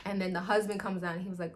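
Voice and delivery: ordinary delivery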